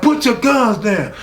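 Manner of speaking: high, forceful retold command; retold yelled order. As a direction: imitate yelling out orders like the chief. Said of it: "I'm yelling out orders like I'm the police chief".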